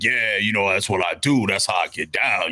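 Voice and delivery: low, cool rapper act; rapper persona. Retold or imitated. Rapper playing it up for a fan, low cool act. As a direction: lightly put on a rapper's low-key swagger. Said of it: "they turn on I'm a rapper".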